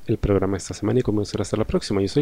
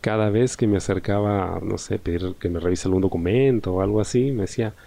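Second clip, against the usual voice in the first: ordinary talk, nothing marked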